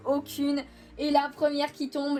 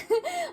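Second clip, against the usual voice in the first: short high giggle. Real, not acted. Amused laugh.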